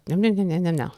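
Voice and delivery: rapid-fire mock gabble; mocking fast talk. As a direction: imitate someone rushing their words. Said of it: "Like, really fast".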